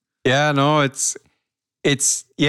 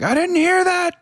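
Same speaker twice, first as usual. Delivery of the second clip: high-pitched mock incredulity; acted disbelief. Listener hearing an actor's real accent, mock disbelief. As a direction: recoil from what you heard, pitch shooting up.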